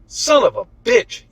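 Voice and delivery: sudden high angry yell; pained shout. A narrator's reading. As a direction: curse out loud in pain. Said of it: "yelled Michelangelo".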